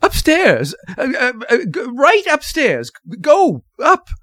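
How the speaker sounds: very high, panicked stuttering; flustered, high-pitched frantic directions; hysterical stammer. A narrator's reading. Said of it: "she cried hysterically".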